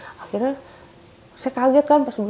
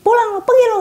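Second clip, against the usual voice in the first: much higher, louder shout; angry yell; 12 semitones higher, 6 dB louder, much faster than usual. Retold or imitated. Husband driving the technician out, shouting. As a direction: chase him out with a yell.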